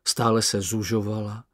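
ordinary delivery

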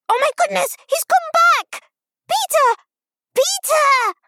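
very high, loud squeal; frantic child calling out. A narrator's reading. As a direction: shriek a warning to the stage. Child calls warning to the stage.

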